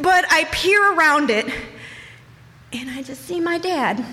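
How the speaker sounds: normal talking voice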